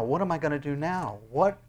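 normal talking voice

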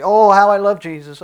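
loud, high, gushing proclamation; mock devotion. Preacher imitating people who only say they love Jesus, loudly gushing. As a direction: imitate a showy believer, belting it out high. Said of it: "there are many people that say".

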